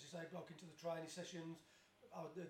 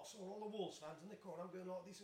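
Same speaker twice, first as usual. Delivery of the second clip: usual speaking voice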